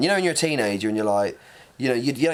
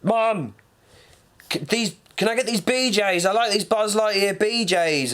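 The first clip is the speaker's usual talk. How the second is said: put-on much higher, childlike pleading; little kid voice; 10 semitones higher than usual. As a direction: beg like a small child. Child asking mum.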